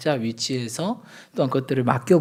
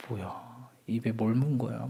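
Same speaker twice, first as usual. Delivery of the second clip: ordinary talk, nothing marked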